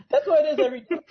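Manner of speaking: cracking up at the joke; laughing. Real, not acted. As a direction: laugh while you say it.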